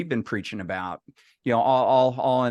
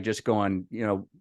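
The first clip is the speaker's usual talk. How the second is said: ordinary talk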